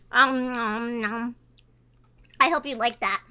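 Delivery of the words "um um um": muffled mumbling through food; low sounds round a mouthful; mouth full. Shown as a demonstration. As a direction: chew through the words. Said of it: "I'm about to shove this all in my mouth".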